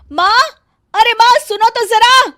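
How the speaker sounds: bossy bahu hollering for her sister-in-law; calling out angrily. Acted. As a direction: yell for her from another room. Megha calls the mother.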